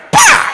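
sharp, very high "Pa!" slap; slap sound effect. Acted. Preacher mimics the mother's backhand slap. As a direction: crack it out like a slap.

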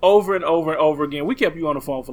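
ordinary delivery

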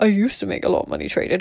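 quick, soft echo of oneself; amused self-mimicry. Speaker amused by her own line, quick self-mimicry. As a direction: toss it off quickly and softly, mock boasting.

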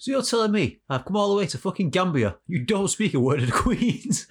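high, hammed-up outrage; affronted disbelief, drawing out the end; indignant English tourist. English tourist scolding a local in Gambia, mock outrage. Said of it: "that is the English attitude".

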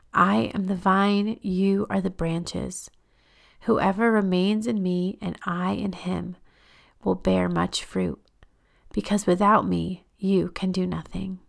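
very unhurried, measured verse; deliberate, reverent pacing; slow scripture reading. Real, not acted. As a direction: read it slowly, resting between phrases. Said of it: "I'm just gonna read it really slowly".

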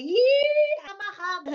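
mock alarm. Recoiling from spooky idea.

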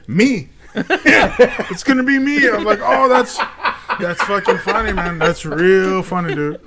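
high, loud, laughing retelling; laughing through it. Real, not acted. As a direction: crack up while quoting the joke. Laughing while retelling the joke.

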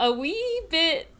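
cracking on the word wee. Real, not acted. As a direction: voice breaking with nerves. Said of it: "voice cracked on that too".

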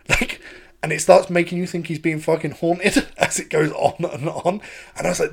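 ordinary talk, nothing marked

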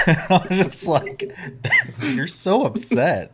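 high, laughing teasing at the upset dwarf; giggling mock sympathy. Real, not acted. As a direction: laugh through it, slowly. Laughing at Brock's outrage.

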